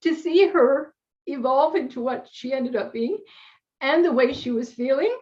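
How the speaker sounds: fighting back tears while telling it; tearful. Real, not acted. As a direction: voice rising and catching with real tears. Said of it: "I don't know why I'm crying".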